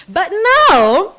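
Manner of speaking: bursting out high and loud; a sudden lift of real excitement; excited. Real, not acted. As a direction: pitch jumps up as the mood turns bright. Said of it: "I'm excited".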